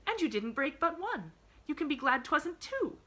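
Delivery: put-on high, eager child voice; little girl warming up. A narrator's reading. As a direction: press on keenly in a girlish pitch. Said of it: "Pollyanna was warming to her task".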